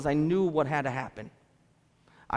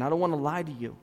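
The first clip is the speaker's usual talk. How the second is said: ordinary delivery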